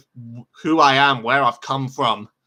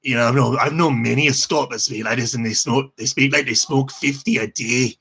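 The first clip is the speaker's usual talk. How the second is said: grumpy Scot with a heavy smoker's voice; grouchy, smoky Scottish voice; grouchy Scottish accent. Shown as a demonstration. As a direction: growl it like a Scot on fifty a day. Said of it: "the grouchy one, like this kind".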